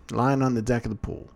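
plain reading voice

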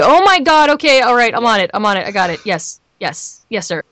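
high burst of panic, settling into compliance; frantic eagerness. Acted. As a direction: jump on it, flustered and eager. Acted frantic reaction to work.

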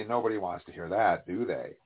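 ordinary delivery

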